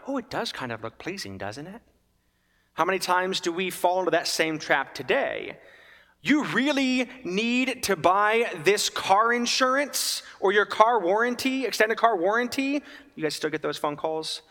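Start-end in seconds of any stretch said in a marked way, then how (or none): 0.1-1.8 much softer, lower, tempted murmur; a hushed, tempted aside; tempted musing. Retold or imitated. 6.2-12.8 hammed-up warranty robocall; mock telemarketer. Mocking telemarketer pitch.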